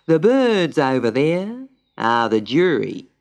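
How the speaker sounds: slow, ponderous pelican pronouncement; solemn pelican judge. A narrator's reading. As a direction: draw out each word with great gravity.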